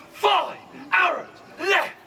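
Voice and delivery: slow "Five arrows left", each word punched high; dramatic. Shown as a demonstration. Archer shows the crowd how to answer, slow drama. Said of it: "You gotta give me a bit of drama with it".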